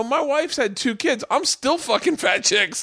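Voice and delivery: high, slow, strained-up plea; high-pitched comic desperation; desperate pleading. Retold or imitated. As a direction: plead with the crowd high and drawn out. Pleads with silent audience, retold.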